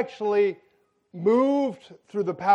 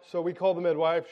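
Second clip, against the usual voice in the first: usual speaking voice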